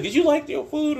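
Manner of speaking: imitating Amy asking innocently; voicing his friend. Retelling his friend asking if he liked the food, in a high mock voice. Friend asks about the meal.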